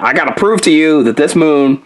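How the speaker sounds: ordinary talk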